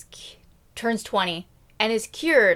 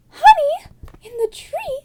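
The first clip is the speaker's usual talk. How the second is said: high, slow astonished squeal; mock excited; 6 dB louder than usual. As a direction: gasp it out as if delighted. Princess excited discovery.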